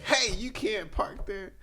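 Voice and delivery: lightly voiced objecting bystander, drawn out; lady objecting. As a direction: imitate someone objecting, slow and high.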